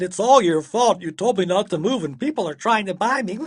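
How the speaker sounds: loud, high, quick protest; indignant outburst heading into tears; upset accusing child. A narrator's reading. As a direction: wail the blame at Dad in a rush. Taya blames father, upset.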